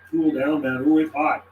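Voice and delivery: usual speaking voice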